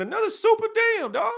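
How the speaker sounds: a high, yelled squeal of hype; excited shout. Real, not acted. Streamer hypes a chat supporter with a high excited shout. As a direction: cheer the donor on.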